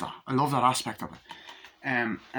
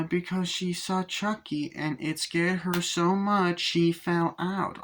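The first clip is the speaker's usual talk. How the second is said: put-on child voice; 7 semitones higher, slower than usual. Child answering detective.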